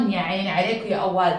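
ordinary delivery

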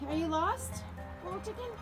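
ordinary talk

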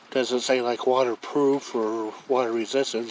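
usual speaking voice

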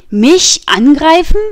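high, loud and slow disbelief; astonished and scornful. A narrator's reading. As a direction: scoff at the very idea. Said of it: "ebenso erstaunt wie verächtlich".

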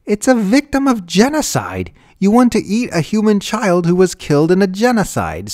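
high, loud, desperate protest; shrieking disbelief; horrified scream. A narrator's reading. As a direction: scream it at the family in outrage. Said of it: "screamed Susan".

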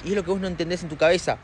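ordinary delivery

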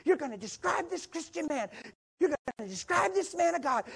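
high, urgent retold protest; freaking out. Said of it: "She freaked on me".

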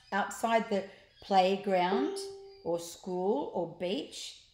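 usual speaking voice

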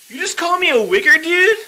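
high, loud, incredulous challenge; put-on indignant yell; mock outrage. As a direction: demand it, rising sharply at the end. Recording an outraged response video.

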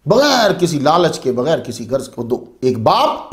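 usual speaking voice

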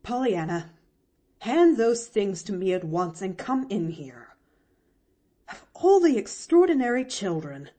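a strict aunt giving orders; stern. A narrator's reading. Aunt orders the girl in from the roof, stern. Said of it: "she said sternly".

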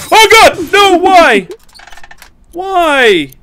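high, loud frightened cries; panicked screaming. Real, not acted. Monsters appear in game.

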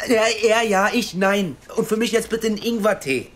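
loud, high stammering order; flustered calling. Acted. Correcting his order to waitress Jenny, loud flustered call. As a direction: fumble the order aloud.

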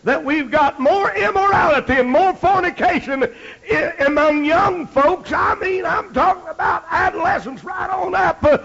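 high, loud fiery preaching; fire-and-brimstone sermon climax; preaching at full force. Acted. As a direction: build it up to a rousing shout.

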